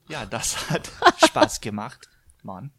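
slow, loud German sign-off. Pretend. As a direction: say it in German with Jamaican flair. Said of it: "I wanna hear it in German".

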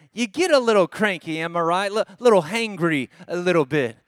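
normal talking voice

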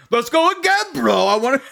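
high, pumped-up bro; amped winner begging for a rematch; hyped bro voice. Retold or imitated. Imitating excited Brandon.

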